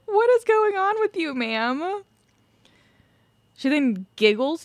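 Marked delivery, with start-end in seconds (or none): 0.1-2.0 baffled, high. Real, not acted. Reacting to the bizarre footage.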